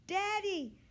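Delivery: put-on high, loud child's scream; girl begging her father not to let the dog out, screaming. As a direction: shriek it at Daddy in panic. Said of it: "screamed Zibby".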